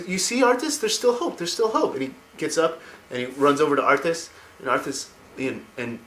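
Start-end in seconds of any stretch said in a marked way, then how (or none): 0.1-1.9 put-on very high childlike voice; boy's voice. Boy urges Arthas there's hope, very high voice. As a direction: pitch it up like a small hopeful kid. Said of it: "He's like".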